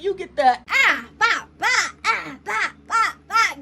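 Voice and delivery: high, sung-out bop bop beat; mock beat sounds. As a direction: scat the club beat in a high voice. Imitating the dance beat.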